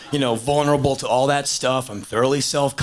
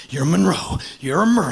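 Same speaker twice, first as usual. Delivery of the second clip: intense coaching; 3 semitones higher, 5 dB louder, faster than usual. Retold or imitated. Director psyching up the actor.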